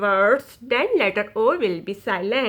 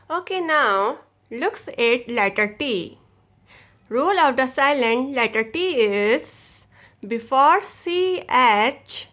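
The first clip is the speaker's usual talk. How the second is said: ordinary delivery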